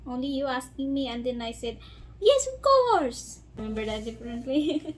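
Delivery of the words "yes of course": excited. Retold or imitated. Retelling her eager acceptance.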